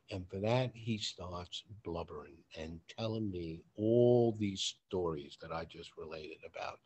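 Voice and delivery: ordinary talk, nothing marked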